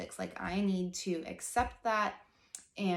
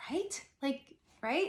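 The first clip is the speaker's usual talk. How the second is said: eager agreement; 7 semitones higher, slower than usual. Retold or imitated. Eager agreement with his remark.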